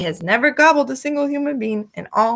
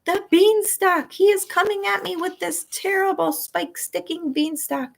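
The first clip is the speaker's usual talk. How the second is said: high, slowed desperate pleading; begging giant; 8 semitones higher than usual. A narrator's reading. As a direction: beg for mercy from Jack.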